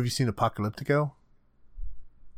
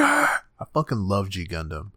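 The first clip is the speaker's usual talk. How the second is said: ordinary talk, nothing marked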